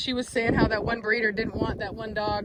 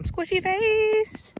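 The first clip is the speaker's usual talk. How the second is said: much higher, slow squeal; high squeaky coo; 11 semitones higher, much slower than usual. Real, not acted. Owner squealing a pet name at her puppy, high and slow. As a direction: stretch it out in a tiny high voice.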